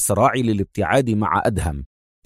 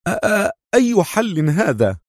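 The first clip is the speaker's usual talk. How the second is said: high, stammering, nervous question; tense; 9 semitones higher than usual. A narrator's reading. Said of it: "سأله خالد في توتر".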